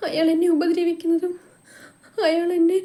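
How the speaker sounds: high, tearful and broken, words catching in the throat; a young woman breaking down as she confesses; sobbing. A narrator's reading. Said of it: "Kalyani പൊട്ടിക്കരയാൻ തുടങ്ങി".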